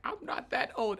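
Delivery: offended protest. Retold or imitated. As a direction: insist like an insulted teenager. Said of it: "I was so mad".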